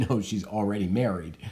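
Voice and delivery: ordinary talk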